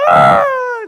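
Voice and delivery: high loud mock cry; hammed-up toddler wail; toddler's wail imitated. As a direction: shriek like a punished two-year-old. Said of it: "she'll be".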